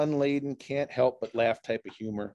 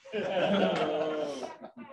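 laughing at own joke; 5 semitones higher, 4 dB quieter than usual. Real, not acted. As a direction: chuckle after the punchline.

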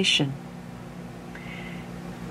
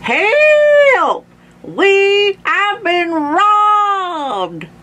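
very high, drawn-out cry for help; old man raising the alarm at a high pitch; Grandpa shouting; 5 dB louder, much slower than usual. A narrator's reading. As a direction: wail it out slowly for the whole house. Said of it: "We heard Grandpa shouting".

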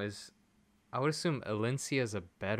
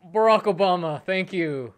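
excited thanks; 6 semitones higher, 8 dB louder than usual. Real, not acted. Great pull after the Obama bit.

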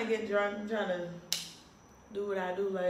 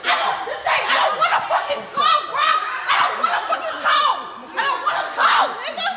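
yelling; 10 semitones higher, 4 dB louder than usual. Real, not acted. Drunk girl refusing to talk, yelling.

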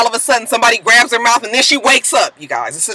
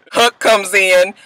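ordinary delivery

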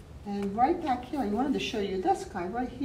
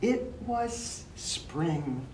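slow storytelling; much slower than usual. A narrator's reading. Slow dramatic story line.

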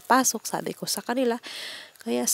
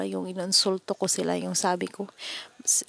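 normal talking voice

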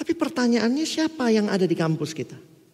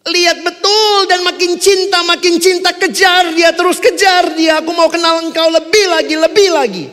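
passionate preaching; 12 semitones higher, 11 dB louder than usual. Acted. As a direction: rouse them to chase God. Preaching at full force.

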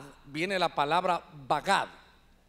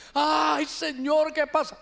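higher, louder hammed-up moan; mock moaning plea; 7 semitones higher, 4 dB louder, slower than usual. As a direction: groan it like a distraught worshipper at the altar. (gemido exagerado y agudo de un feligrés)

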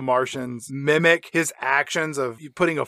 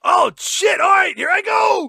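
high, loud hammed-up yell; mock panicked shout; 14 semitones higher, 5 dB louder than usual. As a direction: yell as you take the plunge. [name] jumps down the chute.

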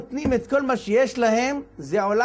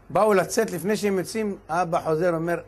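usual speaking voice

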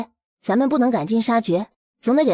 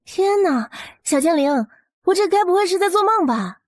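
high, amazed disbelief; 9 semitones higher than usual. Acted. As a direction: gasp at a dream come true. Amazed at herb field.

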